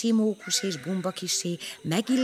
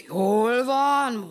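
growled; 4 semitones higher, 4 dB louder than usual. Acted. Elephant growling at the flattery. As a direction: growl it, pleased. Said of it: "morogta a hízelgésre az elefánt".

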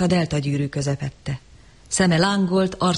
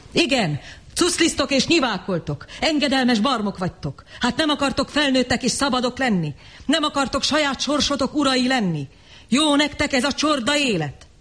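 scornful tirade; 8 semitones higher than usual. Acted. Savage insults the crowd.

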